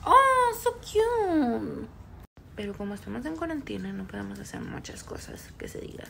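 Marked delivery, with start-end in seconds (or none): 0.1-1.9 high delighted coo. Real, not acted. Cooing at pet. 3.9-6.0 quick hushed aside; fast whisper. Real, not acted.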